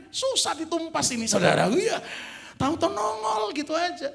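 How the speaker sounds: high, loud preacher's cry; fervent preaching. Real, not acted. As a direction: hammer the point to the congregation. Preaching at full force.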